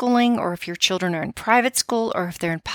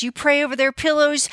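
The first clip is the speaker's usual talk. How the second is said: usual speaking voice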